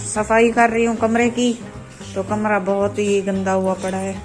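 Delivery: ordinary delivery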